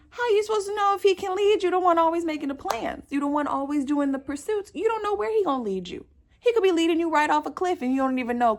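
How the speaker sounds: loud, high, rapid-fire rant; heated scolding. Real, not acted. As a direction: scold them at full tilt, fast and high.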